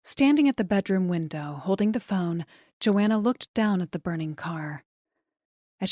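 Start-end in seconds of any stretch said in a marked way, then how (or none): none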